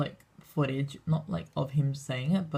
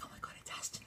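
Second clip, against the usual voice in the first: hushed, whispered fan gasp; mock starstruck whisper; 19 dB quieter, faster than usual. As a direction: imitate awed fans whispering. Imitating starstruck fans spotting Ashton.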